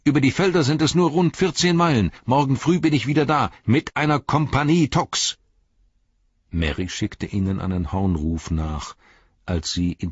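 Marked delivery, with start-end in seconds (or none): 0.0-5.4 high, loud call while riding off. A narrator's reading. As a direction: Pippin shouting back to friends, excited. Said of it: "rief er". (laut und hoch gerufen)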